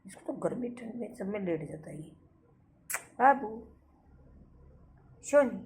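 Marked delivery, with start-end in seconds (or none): 3.2-5.6 high, loud pet-name calls; calling the pet. Real, not acted. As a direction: call the dog sweetly but loud. Calling pet dog by names. (पालतू को ऊँची आवाज़ में पुकारना)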